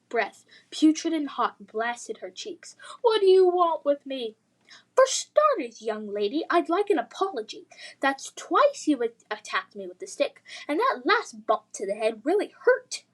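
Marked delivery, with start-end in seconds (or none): 3.0-4.4 high, loud frightened plea. Acted. Lily cornered by dragon. 4.9-13.0 put-on prim, refined English accent, lightly done; posh English accent. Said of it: "The dragon spoke in a posh English accent".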